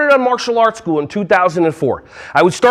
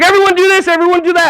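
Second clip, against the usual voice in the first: bossy teacher commands; 9 semitones higher, faster than usual. Retold or imitated. New preschool teacher ordering kids around, high and bossy. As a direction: boss the room around. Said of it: "and I'm just like".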